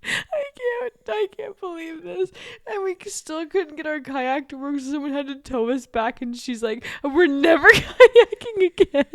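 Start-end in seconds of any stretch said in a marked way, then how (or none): 0.0-2.3 high, breaking into helpless laughs; retold hysterics, real laughter underneath; crying with laughter. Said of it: "just crying". 7.0-9.0 loud, raised voice with a laugh; hammed-up last straw, amused; mock fed-up vow. Sister's vow retold.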